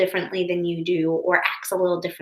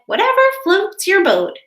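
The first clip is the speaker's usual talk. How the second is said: bright, high, louder teacher quote; cheerful teacher; 10 semitones higher, 5 dB louder than usual. Acted. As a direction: chirp it like a breezy teacher.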